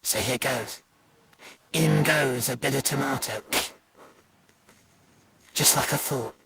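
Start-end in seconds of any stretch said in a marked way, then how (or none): none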